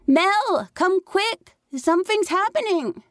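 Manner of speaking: put-on high child's voice, pleading and urgent; a six-year-old begging his sister to come; urgent little boy. A narrator's reading. Little brother calls for his sister.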